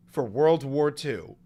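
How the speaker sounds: usual speaking voice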